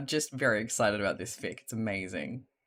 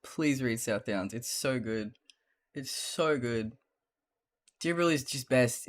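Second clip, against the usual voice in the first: ordinary delivery, nothing marked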